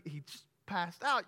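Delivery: ordinary talk, nothing marked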